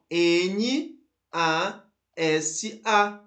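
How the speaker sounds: "N A S A" letter by letter, each name drawn out; spelled out slowly. Shown as a demonstration. Teacher showing students the initials, "N A S A" spelled out slowly. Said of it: "Então, ó".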